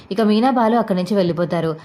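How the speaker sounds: usual speaking voice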